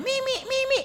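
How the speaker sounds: put-on very high, loud toddler cry; child's shout. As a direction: yell for milk like a toddler at dawn. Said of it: "pag sumigaw na ng". (sigaw ng batang humihingi ng gatas sa madaling araw)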